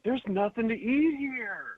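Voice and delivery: high, slow comic whine; mock whining complaint. Co-host mocking buffet diner, slow high whine. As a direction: drag it out softly in a high complaint.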